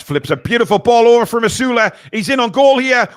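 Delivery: high, loud goal shout; excited goal call. Real, not acted. Calling a goal live.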